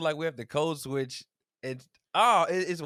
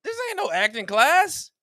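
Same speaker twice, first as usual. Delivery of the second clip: bewildered self-scolding; 13 semitones higher, 9 dB louder than usual. Retold or imitated. Mock bewilderment at himself.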